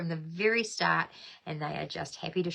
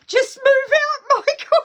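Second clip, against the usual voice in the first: high, loud squealed tease; shrieking joke; 12 dB louder, slower than usual. Real, not acted. Host teasing a viewer, squealing with laughter. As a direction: shriek it out at Michael. Jokes at Michael's address question.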